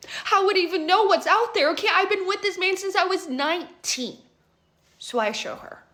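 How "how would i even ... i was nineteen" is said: higher and louder, upset protest; a distressed cry of protest, higher; upset outburst. Retold or imitated. Friend protesting she knows nothing of dating, higher and louder.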